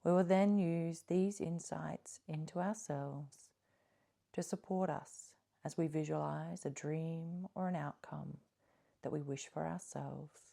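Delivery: soft, calm meditation voice with long pauses; guided meditation. Acted. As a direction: gently guide listeners inward, leaving space between phrases.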